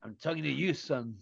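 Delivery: put-on a gentle, dry teasing reply to a boy; mock Billy Bremner. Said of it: "That's what he used to say".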